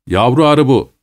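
plain reading voice

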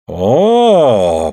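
long, swooping contented "oh"; pleased drawl. A narrator's reading. Suль approves Andrei's hit, pleased drawn-out exclamation. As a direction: stretch the vowel out with satisfaction. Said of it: "протягивает довольно Суль".

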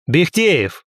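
called out. A narrator's reading. Emperor summoning his secretary Bekhteev, mildly shouted. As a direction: call for the secretary, voice lifted. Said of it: "крикнул я".

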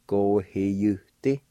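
Go-he-you-ti drawn out slowly with the Y sounded as you; spelled-out Y. Shown as a demonstration. Teacher showing learners the spelled-out Go-he-you-ti, slowly. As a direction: say Go-he-you-ti slowly, giving the Y its full you. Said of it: "it would sound like this".

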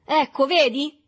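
esclamazione forte e acuta; grido trionfante di sfida. A narrator's reading. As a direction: gridalo in faccia all'avversario. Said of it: "gridò l'altro scuotendo il pugno". (urlo di rivalsa)

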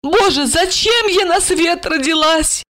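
high-pitched tipsy woman lamenting; heartfelt drunken complaint. A narrator's reading. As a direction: pour out self-pity in a high voice. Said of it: "С чувством вопросила она".

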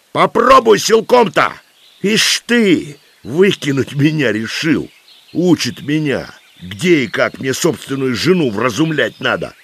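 high, indignant, defiant outburst; old man shouting. A narrator's reading. Old man defies the commander from his own house, indignant shout. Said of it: "закричал старик".

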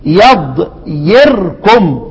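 يضيركم drawn out slowly, every syllable separate; very slow teaching demo of يضيركم; spelled-out demo. Shown as a demonstration. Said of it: "أصلها إيه؟".

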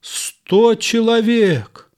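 high, slow, amazed сто человек; incredulous. A narrator's reading. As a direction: draw out сто человек in disbelief. Hearing of a hundred foes.

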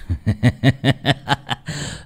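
high mocking cackle; evil laugh. A narrator's reading. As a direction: laugh in his face with contempt. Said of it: "gã cười lớn".

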